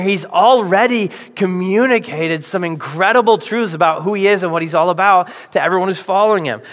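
ordinary talk

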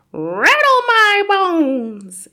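high, loud, long-drawn wail; spooky ghost voice. A narrator's reading. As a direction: wail slowly like a haunting ghost. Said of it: "soft and spooky this time".

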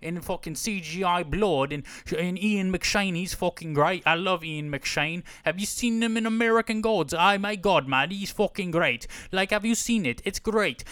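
hammed-up British accent, raving; British bloke. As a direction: gush like an excited British fan. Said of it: "people who are like".

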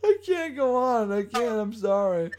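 high, slow, cracking up; too amused to go on; helpless laughter. Real, not acted. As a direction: apologize through real laughter. Breaking up laughing at the accent.

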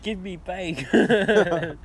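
high, loud, very slow drawn-out voice; silly slow demand. Retold or imitated. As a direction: drag every word out like a caveman.

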